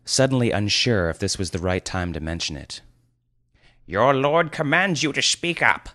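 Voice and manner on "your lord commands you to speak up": pompous wizard. Acted. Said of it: "Grand Alf stated unnecessarily".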